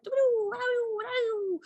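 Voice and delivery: high playful sing-song doo-doo; cat hopping. Retold or imitated. Cat doing little jumps down the stairs, high and playful. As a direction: mimic a cat's bouncy little jumps. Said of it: "He did a little, like".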